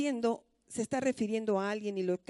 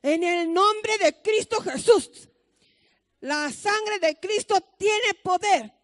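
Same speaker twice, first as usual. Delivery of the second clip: higher, louder, slow then shouting; fervent shouted prayer; 5 semitones higher, 4 dB louder, slower than usual. Retold or imitated. Mother rebuking the sickness over the patient, shouted. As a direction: rebuke the illness with full force. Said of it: "empieza a orar".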